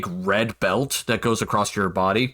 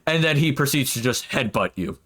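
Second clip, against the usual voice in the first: usual speaking voice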